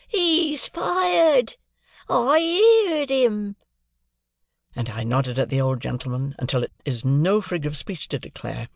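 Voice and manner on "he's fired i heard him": put-on high, loud, drawn-out old man's triumph; the old father crowing with joy at the cannon; exultant cry. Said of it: "cried out exultingly".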